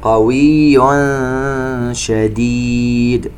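slow chanted tajweed recitation with echoing qāf; Quran recitation. Shown as a demonstration. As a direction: recite slowly, bouncing the qāf. (تلاوة مرتلة ببطء مع قلقلة القاف)

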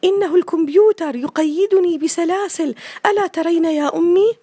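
put-on high, loud childish plea; a boy begging his mother to see; boy pleading. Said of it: "بصوت ضعيف قال وائل".

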